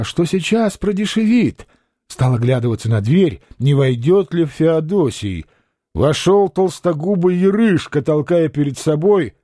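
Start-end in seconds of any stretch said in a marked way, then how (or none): none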